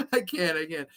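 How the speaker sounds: ordinary talk, nothing marked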